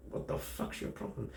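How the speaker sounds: whispered under the breath, half to himself; a private whisper at a rude stare; inner thought whisper. Retold or imitated. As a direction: mutter it like an unspoken thought. Silently thinking at staring girl.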